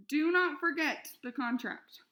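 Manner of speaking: NOT hit hard, slow and loud; stern warning. A narrator's reading. Mother insisting to Flora, loud stressed not. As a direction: lay weight on not, insist. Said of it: "her mother shouted".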